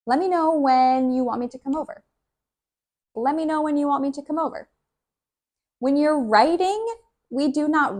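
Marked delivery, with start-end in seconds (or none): 0.0-2.0 fast, run-together "Let me"; brisk, natural native pace; quick casual speech. Shown as a demonstration. 3.1-4.7 high and very fast, "Let me" slurred to "lemme"; rapid, blurred casual delivery; very fast natural speech. Shown as a demonstration.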